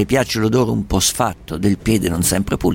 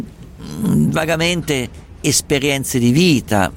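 normal talking voice

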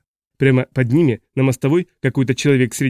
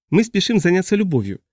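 beaming; 6 semitones higher than usual. A narrator's reading. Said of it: "сообщила она им сияющей улыбкой".

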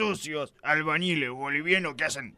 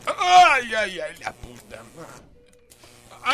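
grito de dolor; 4 semitones higher, 8 dB louder, much slower than usual. Acted. Gets electric shock from register.

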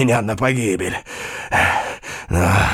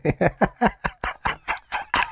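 soft unvoiced cackle; breathy evil laugh; 8 semitones higher, 5 dB quieter than usual. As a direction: laugh under your breath, wickedly. Ulrica gloats over dying knight.

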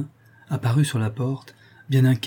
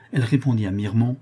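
ordinary delivery, nothing marked

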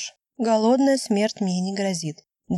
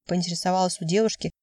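ordinary delivery

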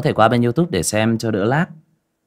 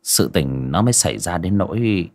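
horror story narration; 5 dB louder than usual. A narrator's reading. Storyteller opening a village ghost tale, grave narration. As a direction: draw listeners into the eerie night.

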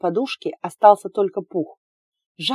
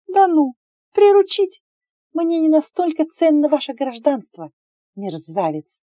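much higher, louder scornful retort; indignant mockery; 8 semitones higher, 5 dB louder than usual. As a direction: throw his words back, outraged. Heroine mocks his taming words.

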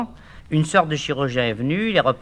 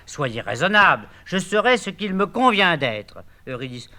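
normal talking voice

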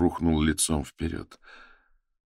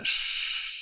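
whispered shush; hushing; 4 dB quieter than usual. A narrator's reading. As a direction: hush him softly.

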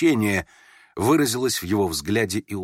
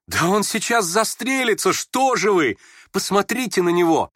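high, panicked plea; frightened cry; 10 semitones higher, 4 dB louder than usual. A narrator's reading. Vera rushing to stop the suicide, crying in fright. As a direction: cry out in extreme alarm. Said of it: "вскрикнула Вера".

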